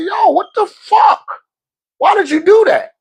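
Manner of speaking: hammed-up shocked husband; mock outrage. Retold outburst at wife.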